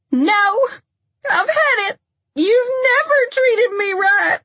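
very high, loud, tearful screaming; furious screaming. A narrator's reading. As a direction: scream the accusation through tears. Lindsay's furious outburst at Dennis.

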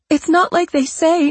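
high, rushed, frightened; scared pleading. Pretend. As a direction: insist in a frightened rush. Patricia's faked panic.

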